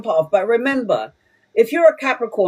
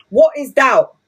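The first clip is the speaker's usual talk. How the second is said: ordinary talk, nothing marked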